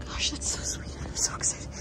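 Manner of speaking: whispered delight; hushed real excitement; excited whisper. Real, not acted. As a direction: whisper it, bursting with joy.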